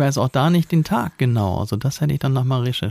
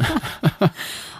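high breathy cackle; 8 semitones higher than usual. Retold or imitated. Retold cackle.

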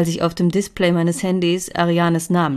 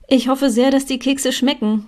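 sweet cooing, higher at the start; 7 semitones higher, 4 dB louder than usual. A narrator's reading. Katinka hands Emilia the cookies, sweetly cooing. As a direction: purr it sweetly to charm her. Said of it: "säuselte ich".

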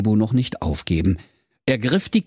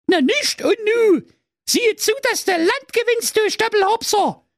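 very high-pitched scolding; nagging fairy. Acted. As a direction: send him packing like a shrewish fairy. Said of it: "keifte die Fee". (keifende Fee, sehr hoch)

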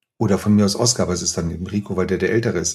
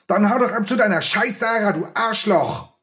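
put-on very high furious boy's yell; Oskar bellowing; 11 semitones higher than usual. Oskar screaming at Rico to leave, very high furious yell. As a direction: scream it at your friend in rage. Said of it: "schrie er mich dermaßen laut an".

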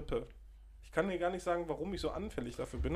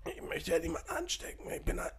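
croaking, feeble whisper, much higher; croaky voice. Retold or imitated. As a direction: croak it out like a sick old man. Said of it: "mit krächzender Stimme".

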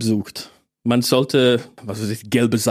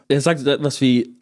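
normal talking voice

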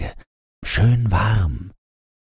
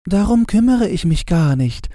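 Gretchen. Acted. Gretchen brushes off the lily, high impatient girl voice. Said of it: "sagte Gretchen".

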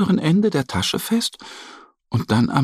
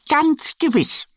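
much higher, bright certain cry; joyful call. A narrator's reading. Annie eagerly promises Mo the bag will work, joyful high cry. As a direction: cheer it out with a happy hop. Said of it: "ruft Annie".

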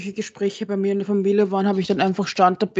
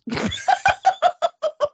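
very high squeal of laughter; high giggle. Real, not acted. Laughs at the idea.

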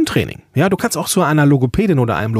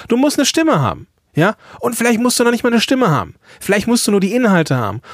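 emphatic; 8 semitones higher, faster than usual. Real, not acted. Coach making emphatic point.

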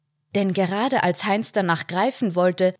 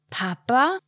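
put-on higher child's voice, questioning; a small girl calling her dad; lightly voiced little daughter; 6 semitones higher than usual. A narrator's reading. Daughter stepping out of the car, a higher child's question. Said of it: "die Stimme seiner Tochter".

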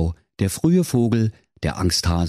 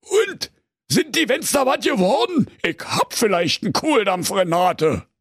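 lightly voiced impatient ogre; 13 semitones higher than usual. A narrator's reading. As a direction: demand dinner impatiently. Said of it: "fragte ungeduldig".

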